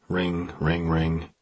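plain reading voice

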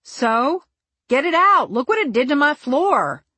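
loud upset shout. A narrator's reading. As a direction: shout it in alarm. Narrator shouts at No Hair.

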